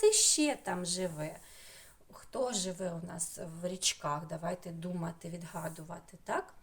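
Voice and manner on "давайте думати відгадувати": ordinary talk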